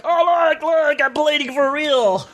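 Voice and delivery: high, slow put-on voice; imitating Nate. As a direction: drag it out, high and dopey. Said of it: "and he's like".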